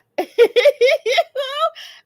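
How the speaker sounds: loud, high-pitched real laughter; squealing laugh. Real, not acted. Reacts to a viewer's comment.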